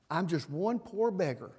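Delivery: ordinary talk, nothing marked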